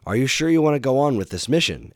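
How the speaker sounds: put-on bright elderly mentor voice; Doctor Light voice.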